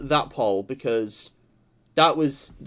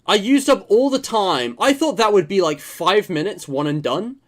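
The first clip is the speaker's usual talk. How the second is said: dismayed; 6 semitones higher, 5 dB louder than usual. Real, not acted. Shocked at elapsed time.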